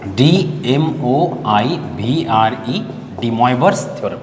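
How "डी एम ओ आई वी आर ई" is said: each letter dictated slowly and apart; spelled out slowly. Shown as a demonstration. Teacher dictating the spelling of Moivre to students, slowly. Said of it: "इसको लिखते हैं कैसे".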